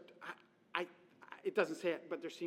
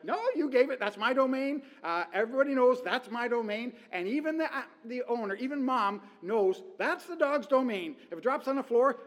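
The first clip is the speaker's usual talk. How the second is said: higher, louder possessive dog voice; voicing the dog; 5 semitones higher, 9 dB louder than usual. Preacher voicing a dog guarding dropped food, smug and possessive. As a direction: claim the floor as your territory. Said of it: "the dog is like".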